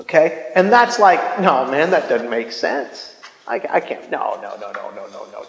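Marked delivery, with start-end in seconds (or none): 1.4-5.4 low, soft dismissive muttering; skeptical intellect. Retold or imitated. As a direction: play the doubting inner voice, trailing down into quiet refusals. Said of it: "the voice of your intellect".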